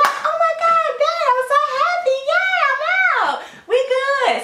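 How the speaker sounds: mock overjoyed squeal. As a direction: squeal with delight in a put-on voice. Voices Shonda overjoyed.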